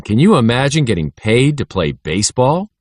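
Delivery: plain reading voice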